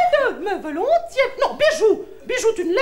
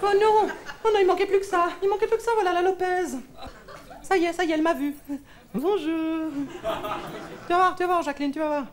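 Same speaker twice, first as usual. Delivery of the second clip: muttered dread; 4 semitones lower, 6 dB quieter than usual. Acted. Dreading rival Lopez, muttering.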